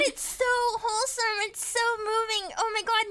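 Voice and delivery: ordinary talk, nothing marked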